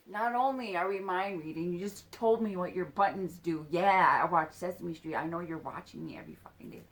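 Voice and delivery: loud retold angry rant, rushing at the end; pissed off. Child confronting him over mind reading, furious retelling. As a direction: burst out indignantly, then tail off. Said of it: "it's pissing her off".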